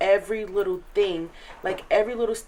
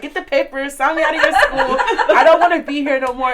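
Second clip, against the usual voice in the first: high, loud laughing whine; hammed-up begging through laughter; laughing mock plea; 7 semitones higher, 10 dB louder than usual. A student laughingly begging her counselor to sign her out.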